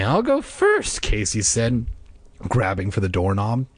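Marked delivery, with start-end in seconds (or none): 0.0-1.0 eager high. A narrator's reading. Boy eager for adventure.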